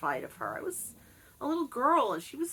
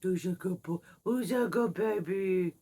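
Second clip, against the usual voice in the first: put-on baby talk. Shown as a demonstration. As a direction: coo at the dog like a baby. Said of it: "The baby talk".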